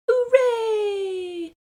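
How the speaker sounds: high joyful shout of hooray; excited cheer. Acted. Little girl cheering on her way to school, high and loud. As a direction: cheer it out, thrilled.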